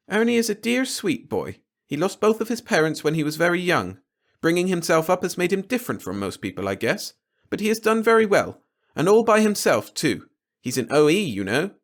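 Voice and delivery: put-on high, earnest young woman; defensive daughter. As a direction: stand up for Ernie with rising pitch. Glory defending Ernie.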